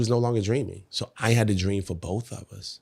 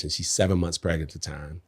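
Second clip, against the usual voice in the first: normal talking voice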